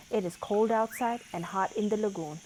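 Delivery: ordinary delivery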